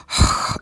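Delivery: heavy puff of breath, mimicked; labored breathing. Mocking someone sitting there just breathing, mimicked heavy sigh. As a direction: imitate someone struggling to breathe. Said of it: "just breathe like".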